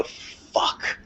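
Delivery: hushed, breathless whisper of alarm; a scared whispered swear in the dark woods; whispered curse. Retold or imitated. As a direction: whisper it like you're afraid to be heard. Cursing in fear at a moose.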